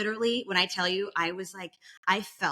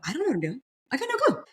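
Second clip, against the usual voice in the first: normal talking voice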